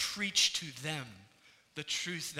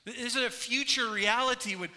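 louder, raised preaching voice, driving the point home; full-force preaching; 7 semitones higher, 8 dB louder than usual. Acted. Preacher proclaiming redemption to the congregation, impassioned preaching. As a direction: proclaim plentiful redemption like a rising sermon.

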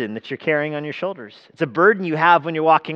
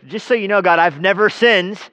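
louder, raised mock claim of innocence; brazen mock boast to God; 6 semitones higher, 6 dB louder than usual. Someone foolishly standing before God to boast, brazen. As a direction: announce it boldly, as a foolish boast. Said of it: "dumb enough to stand before God and say".

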